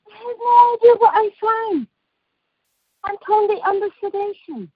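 "I have no idea" shouted high and loud; outraged shout. Real, not acted. Outraged telling of surgery.

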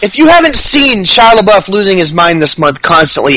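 ordinary talk, nothing marked

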